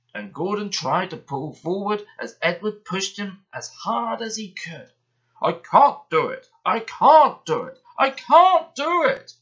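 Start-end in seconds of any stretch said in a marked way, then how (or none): 5.4-9.3 building from strain to a high, loud, slow wail; straining puffs. Acted. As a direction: puff harder each time as the hill beats you. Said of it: "puffed Gordon".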